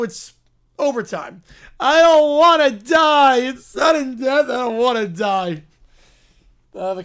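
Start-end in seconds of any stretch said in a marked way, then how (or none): 1.8-5.6 loud, drawn-out wail of not wanting to die; dramatic wail. Acted. Player dreading sudden death overtime, dramatic wail. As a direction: wail it slowly at full volume.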